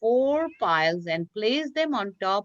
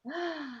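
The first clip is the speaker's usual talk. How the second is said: dramatic gasp; 6 semitones higher, 11 dB quieter than usual. Acted. Storyteller gasps before next event.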